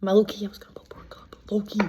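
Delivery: quick whispered secret aside; confiding whisper. Real, not acted. Contestant confessing a secret plan to viewers, whispered. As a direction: whisper it like nobody should find out.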